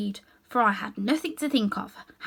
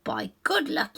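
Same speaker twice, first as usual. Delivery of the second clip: ordinary delivery, nothing marked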